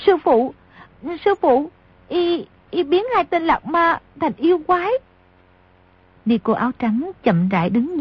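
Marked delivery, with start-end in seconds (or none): 0.1-4.9 put-on high-pitched, halting girlish alarm; frightened, broken-up report in a high voice; panting fright. A narrator's reading. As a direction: stammer it out high, as if running in scared to her teacher. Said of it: "hỗn hển nói".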